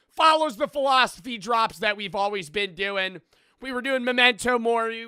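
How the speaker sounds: loud, high-pitched aggressive merch plug; shouted hard sell. Pretend. Streamer ordering viewers not to skip the merch, shouted hard sell.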